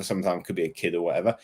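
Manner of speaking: usual speaking voice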